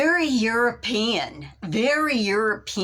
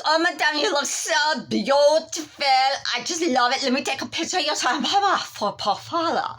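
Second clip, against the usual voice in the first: put-on French accent; 7 semitones higher than usual. French stylist praising the new look in accent. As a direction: coo it in a high French accent. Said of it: "She goes".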